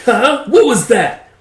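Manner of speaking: mock not having heard; taunting villain. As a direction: demand it in a high villain voice. Villain challenges muttering foe.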